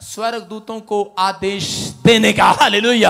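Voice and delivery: loud, rising sermon voice; fiery preaching. Acted. Pastor rousing the congregation, loud and fervent. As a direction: preach it out full force to the crowd.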